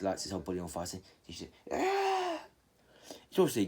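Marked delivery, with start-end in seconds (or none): 1.7-2.4 piercing much higher scream of pain. Retold or imitated. Tsunade caught in the burning genjutsu, high scream. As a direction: shriek as if engulfed in flames.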